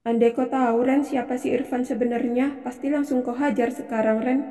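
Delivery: soft, private inner voice; secret aside. Acted. Wife privately wishes Randy knew about Irfan, quiet aside. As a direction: think it aloud, quietly.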